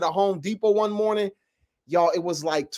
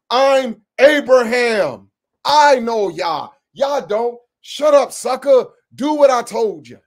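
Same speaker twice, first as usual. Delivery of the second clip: a bossy master barking louder and louder; mock tyrant; 5 dB louder than usual. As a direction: build from grumbling to a shouted order. Voicing Abraham as a tyrant.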